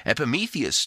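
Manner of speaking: usual speaking voice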